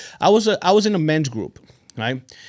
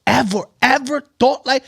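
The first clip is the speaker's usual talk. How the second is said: incredulous; 8 semitones higher, 5 dB louder than usual. Retold or imitated. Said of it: "I'm like".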